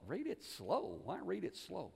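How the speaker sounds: soft, questioning echo of "Read it slow"; puzzled thought. Retold or imitated. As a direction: wonder it quietly to yourself. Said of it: "And I thought".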